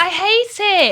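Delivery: high-pitched exclamation of dislike; real outburst of loathing in banter; emphatic protest. Real, not acted. Rejecting the granny lifestyle in banter.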